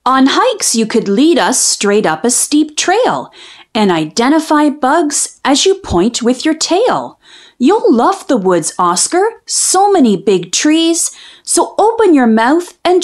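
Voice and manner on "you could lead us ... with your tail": plain reading voice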